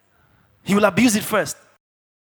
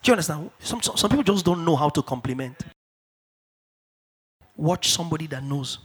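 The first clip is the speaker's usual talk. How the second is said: usual speaking voice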